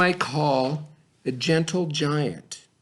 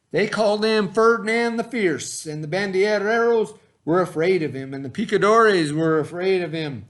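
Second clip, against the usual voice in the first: higher and louder, playing up the fierceness; dramatic build-up; 5 semitones higher, 5 dB louder than usual. A narrator's reading. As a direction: make the bull sound fearsome. Naming Ferdinand the Fierce.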